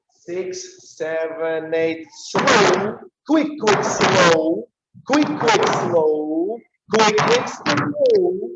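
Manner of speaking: loud rising dance calls; called count. Shown as a demonstration. Teacher calling counts over the music. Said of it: "let's give it more attention".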